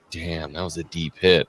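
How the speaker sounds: low, quiet mock-impressed aside; quoted reaction. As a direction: drop it down low and soft. Quoting non-fans' reaction.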